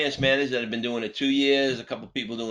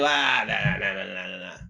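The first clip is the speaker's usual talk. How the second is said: dismissive nah; 4 semitones lower than usual. Retold or imitated. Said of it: "you may look at them and say".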